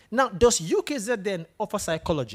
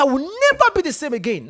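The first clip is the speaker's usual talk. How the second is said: louder and higher, fed up with himself; mild angry vow; 6 semitones higher, 5 dB louder than usual. Retold or imitated. Student vowing to change, retold with mild anger. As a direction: slam the door on his old habits. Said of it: "So he was angry with himself".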